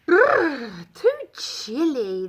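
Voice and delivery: shivering, squealing cookie voice; shivery squeal. A narrator's reading. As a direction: squeal it through chattering cold. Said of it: "the gingerbread man squealed".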